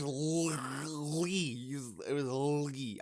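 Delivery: "Lee" with a drawn-out, struggling palatal L; slow, effortful L at the start of "Lee"; attempting Italian "gli". Shown as a demonstration. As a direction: stretch the L of "Lee", fumbling for the Italian sound. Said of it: "I can't do the sound".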